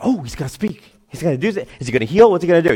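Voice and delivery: lightly acted onlookers, hurried questions; a hint of eager bystanders; excited crowd. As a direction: murmur with a touch of anticipation. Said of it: "like, the crowd".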